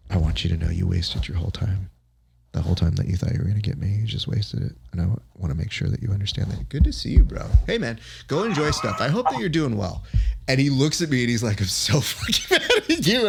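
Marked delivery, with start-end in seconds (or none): 0.1-6.7 hushed, needling whisper to a rival; conspiratorial whisper. Retold or imitated. Leans in to taunt his rival privately. 6.7-10.0 higher, breezy goodbye with a laugh in it; mock-friendly send-off. Sudden friendly send-off after whispered taunt. 11.6-13.3 high-pitched, laughing imitation of an angry rival; mock exasperation.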